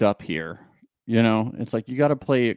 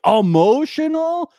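higher, louder, slowed emotional; mock shocked question; 12 semitones higher, 7 dB louder, slower than usual. Family asking him, shocked and higher. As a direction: stretch out Emotional in disbelief. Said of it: "they were like".

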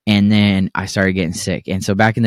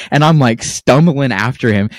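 normal talking voice

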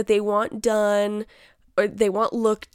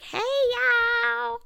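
high mock Southern "Hey, y'all"; imitating Brittany Broski. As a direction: squeak out a Southern hey y'all much higher. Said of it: "Brittany Broski when she does her impression".

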